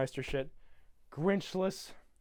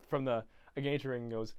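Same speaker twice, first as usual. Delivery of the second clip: ordinary delivery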